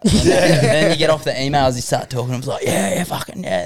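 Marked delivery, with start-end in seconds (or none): 2.6-3.6 slow, higher, broad Western Sydney drawl of yeah; broad Western Sydney accent. Retold or imitated. Guest dropping his Western Sydney accent on TV people. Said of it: "the accent and whatever else".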